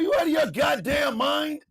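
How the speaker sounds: high, shouted disbelief; outraged retort. Real, not acted. Host answering the clip, high outraged shout. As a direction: yell it at them, incredulous.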